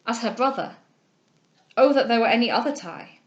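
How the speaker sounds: rising heartfelt exclamation; impassioned cry. A narrator's reading. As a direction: pour out a lover's wish. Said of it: "cried he".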